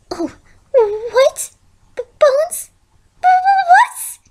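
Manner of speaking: rising, stammering panic; panicked stammer. Acted. Fluttershy realises the bones are hers, panicked stammer. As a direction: stutter in growing terror.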